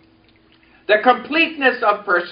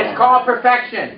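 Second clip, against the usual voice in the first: calling out the line for echo; preacher's call line; congregation repeat-after-me; 3 semitones higher than usual. Shown as a demonstration.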